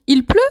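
il pleut? with a rise on pleut; question intonation. Shown as a demonstration. Teacher demonstrating an informal question, rising tune. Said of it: "c'est l'intonation qui fait la question".

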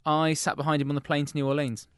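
ordinary talk

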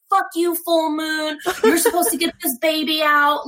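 mock screamed cursing. Overdue mother retelling her cursing at the full moon, mock screaming. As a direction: curse out the sky in a hammed-up yell. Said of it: "just screaming, like, cursing at".

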